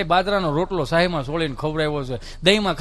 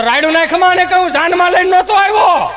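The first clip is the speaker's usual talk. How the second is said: much louder, higher comeback; loud retort; 9 semitones higher, 8 dB louder than usual. Real, not acted. Husband retorting to wife across the house, loud raised voice. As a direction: fire back at the wife.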